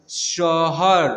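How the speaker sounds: very slow, drawn-out reading; syllable by syllable. Shown as a demonstration. Teacher modelling a word for children, very slowly. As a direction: read it syllable by syllable. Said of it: "repeated reading drill".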